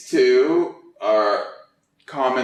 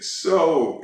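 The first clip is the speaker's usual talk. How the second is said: ordinary delivery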